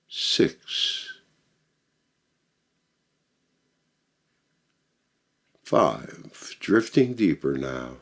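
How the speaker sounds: slow, soothing meditation voice with long pauses; measured hypnotic delivery, settling at phrase ends; guided meditation. Acted.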